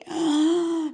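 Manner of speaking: loud, high pained gasp; dramatized sharp gasp. Retold or imitated. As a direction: suck in air as if in agony. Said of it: "because I'm in so much pain".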